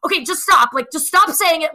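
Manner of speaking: imitating an annoyed partner telling her to quit; mock annoyed. Partner cutting off her self-mockery, mock annoyed. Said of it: "you get mad at them".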